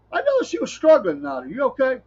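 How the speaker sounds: high, sympathetic mock concern; concerned churchgoer. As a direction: imitate a worried church lady. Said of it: "somebody comes and goes".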